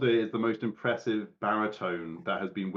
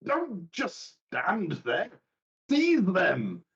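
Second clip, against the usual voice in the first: slow, then a sharp higher command; head servant orders; 8 semitones higher, much slower than usual. Acted. Head servant ordering soldiers, commanding. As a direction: servant barking at the sergeant to seize them. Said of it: "and goes".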